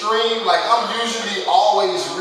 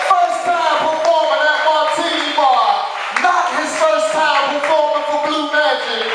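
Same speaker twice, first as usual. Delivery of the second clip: hype announcer; 7 semitones higher, slower than usual. Acted. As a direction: build the crowd into a roar. MC hypes comedian's entrance.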